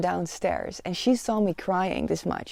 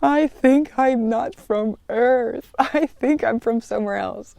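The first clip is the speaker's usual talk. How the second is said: slow, higher, tearful confession; a hint of a sob, slow and raised; through sobbing tears; 5 semitones higher, 4 dB louder, slower than usual. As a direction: admit it haltingly while crying. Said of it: "through my sobbing tears".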